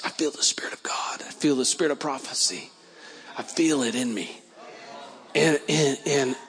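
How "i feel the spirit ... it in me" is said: soft, awed whisper; whispered testimony of the Spirit. Retold or imitated. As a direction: hush it as if in awe. Awed moment of feeling God.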